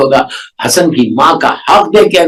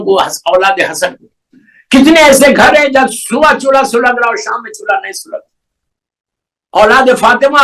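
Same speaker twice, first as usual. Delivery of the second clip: raised, higher fervent sermon appeal; impassioned preaching; 10 semitones higher than usual. Acted. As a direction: plead with the listeners, rising in fervor. Preaching at full force. (پرجوش خطابت، بلند آواز میں appeal)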